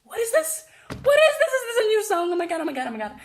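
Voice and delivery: very high, loud excited shrieking; reliving a high, loud fangirl squeal; fan screaming. Retold or imitated. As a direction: scream like a fan seeing her idol. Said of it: "I'm like".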